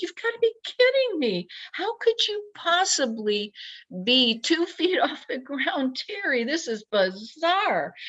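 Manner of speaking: high, quick incredulous self-talk. Retold or imitated. Man talking to himself while floating, high incredulous. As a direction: pitch up high in stunned disbelief. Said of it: "I'm like".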